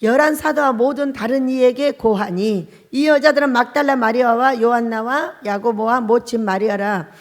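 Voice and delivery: loud, measured Bible reading; scripture reading. Acted. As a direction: read the verses out like a preacher. Reads scripture aloud.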